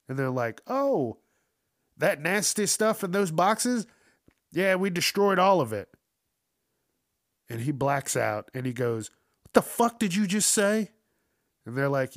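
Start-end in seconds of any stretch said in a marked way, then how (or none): 0.7-5.9 high sweet prissy mom voice, louder; prim mother. 9.4-10.9 high, loud mock outburst; the son snaps after hearing the news; enraged son.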